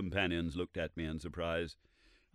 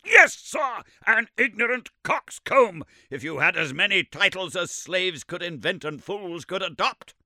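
"an ignorant coxcomb" shouted much higher and louder; insult shouted; 11 semitones higher, 10 dB louder than usual. Acted. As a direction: throw the insult in his face. Summerlee repeating the insult louder.

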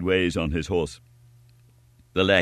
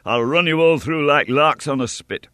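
louder, higher menace; furious threat; 6 semitones higher, 4 dB louder than usual. A narrator's reading. Young hero to innkeeper and family, raging threat. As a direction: threaten them all.

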